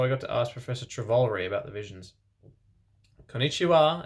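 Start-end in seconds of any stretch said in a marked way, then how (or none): 3.3-4.1 Japanese greeting. A narrator's reading. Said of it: "said Professor Sinister in Japanese".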